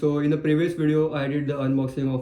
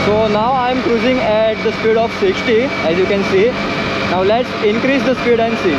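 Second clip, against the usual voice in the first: loud voice over wind noise; speaking loudly; 4 semitones higher, 5 dB louder than usual. Real, not acted. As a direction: raise your voice over the wind. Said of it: "I have to speak very loudly".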